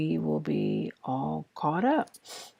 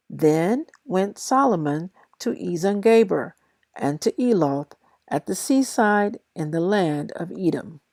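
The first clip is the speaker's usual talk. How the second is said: steady, measured Bible reading aloud; reading scripture aloud to listeners; 3 semitones higher, 4 dB louder, faster than usual. Acted. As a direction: read the verses out with even, careful pacing. Reads Second Chronicles aloud.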